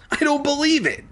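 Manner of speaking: high stunned cry; shocked exclamation; astonished cry. A narrator's reading. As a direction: cry out in disbelief. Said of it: "Doctor D cried".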